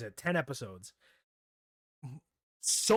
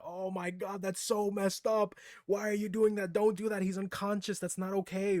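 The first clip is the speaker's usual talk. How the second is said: high, raised protest, pleading; retold freaking out; 6 semitones higher than usual. Fan protesting the kiss while sister listens, high and upset. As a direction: yell at the screen like it can hear you. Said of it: "I was absolutely freaking out".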